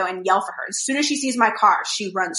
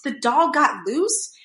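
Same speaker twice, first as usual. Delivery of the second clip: slow, higher incredulous question; retold disbelief; 4 semitones higher, much slower than usual. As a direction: echo it in stunned disbelief, rising at the end. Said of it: "And I was like".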